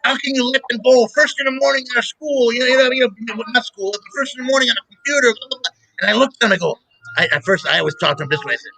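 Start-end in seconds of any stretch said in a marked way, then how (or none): 0.0-5.7 put-on higher, complaining old man rant; a hint of an irritated grandfather; mock grouchy father. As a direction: lightly play the angry dad griping. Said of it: "he started getting pissed off".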